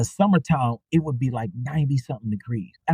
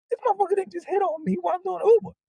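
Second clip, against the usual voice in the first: incredulous; faster than usual. Retold or imitated. Driver's inner disbelief, retold.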